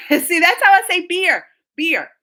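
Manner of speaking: normal talking voice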